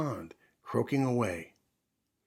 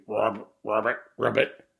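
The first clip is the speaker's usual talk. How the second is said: louder croaking ribbits; loud frog croak; 6 dB louder than usual. Acted. Frog croaking too loud to hear the mouse, loud croak. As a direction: croak away loudly like a frog. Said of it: "Frog was croaking so loudly".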